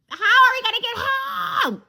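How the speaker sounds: high, slow, loud cry of despair; panicked shout. Retold or imitated. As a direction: shout it like there is no way home. Stranded, panicking about getting home.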